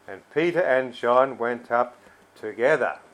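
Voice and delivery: loud Bible reading leaning hard on "together"; stress on together. Shown as a demonstration. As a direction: set "together" apart and land on it firmly. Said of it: "I'll emphasise the key word here as I read it".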